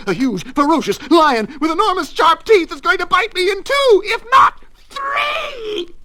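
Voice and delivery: shriek building in panic. A narrator's reading. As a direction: work yourself up into a comic terrified frenzy. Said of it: "shrieked Mister Nervous".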